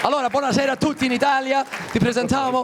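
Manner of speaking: high, fast Italian showman greeting; Italian TV host. Acted. Host greets Italy on air, fast high TV showman. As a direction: greet Italy like a hammed-up TV presenter.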